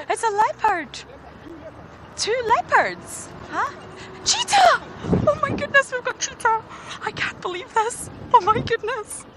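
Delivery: high, loud, breathless squeals of disbelief; real astonished excitement. Real, not acted. Guide suddenly spotting cheetah on Djuma for the first time, astonished. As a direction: burst out high and loud, overwhelmed by the sighting.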